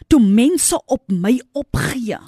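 fervent pulpit cry; preaching at full force. Acted. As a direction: build the sermon to a climax.

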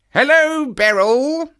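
high, loud, drawn-out greeting; not altogether cordial. A narrator's reading. Brother greets his sister, not quite cordially. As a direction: greet with pointed, forced friendliness. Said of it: "the tone of his greeting was not altogether a cordial one".

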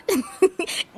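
a short, high snicker at someone; mocking laugh. Real, not acted. Teasing the waitress, a quiet mocking snicker. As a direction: laugh under your breath at her.

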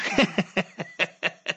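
a short, quiet chuckle; soft laugh. Real, not acted. Host waits for brother Lokolo and laughs quietly to himself. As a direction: chuckle softly to yourself.

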